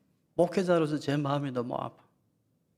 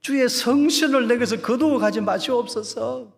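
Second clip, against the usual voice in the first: higher, louder pleading cry of David; David's crying prayer; 8 semitones higher, 7 dB louder than usual. Retold or imitated. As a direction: cry out the psalm like David begging God. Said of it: "다윗이 울부짖었잖아요". (울부짖는 다윗의 기도)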